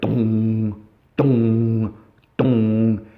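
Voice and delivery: low, ringing mock bell tones; slow low doom-laden dongs; imitating booming music. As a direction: hum the soundtrack's big dramatic hits. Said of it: "The music's really starting to surge".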